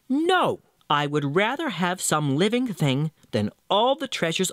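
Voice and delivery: sharp high no, then firm refusal; refusing little man. Acted. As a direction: cut her off with a high no.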